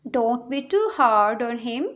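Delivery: slow it down for the class; careful slow teacher repeat. Shown as a demonstration. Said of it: "teacher repeats sentence slowly".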